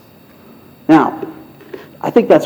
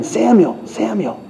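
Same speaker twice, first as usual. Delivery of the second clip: soft calling voice; 4 semitones higher, 6 dB quieter, slower than usual. Acted. God's voice calling again.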